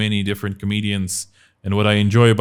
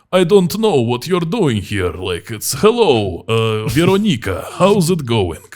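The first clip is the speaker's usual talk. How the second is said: hammed-up movie Russian accent, pitched up; Hollywood Russian accent; 2 semitones higher than usual. Shown as a demonstration. Showing a friend how films fake it, hammed-up Russian accent. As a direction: play a stock Hollywood Russian. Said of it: "Hollywood Russian accent goes".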